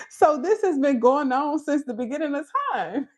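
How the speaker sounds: laughing high through the line. Real, not acted. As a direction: giggle while saying it. Laughing at own joke.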